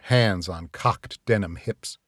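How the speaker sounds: ordinary delivery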